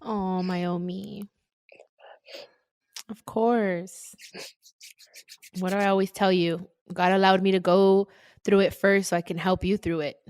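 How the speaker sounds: soft, tender reassurance; tender comforting. Real, not acted. Host comforting her assistant over her loss, tenderly. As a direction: console gently, with softened voice.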